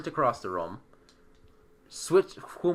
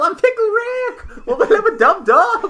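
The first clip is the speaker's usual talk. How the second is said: high, loud catchphrase yell with laughter; Pickle Rick; 13 dB louder than usual. Acted. Player yelling a silly catchphrase to distract, hammed-up yell. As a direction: yell it out like a gleeful cartoon battle cry. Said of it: "and yell".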